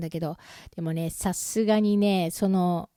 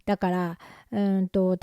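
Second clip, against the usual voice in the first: ordinary delivery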